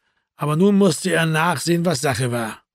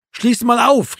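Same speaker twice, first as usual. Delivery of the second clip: high, raised-voice demand; calling out; 7 semitones higher, 4 dB louder, slower than usual. A narrator's reading. Erwin urging Marco to unlock the door, calling out. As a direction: call through the closed door. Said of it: "rief Erwin".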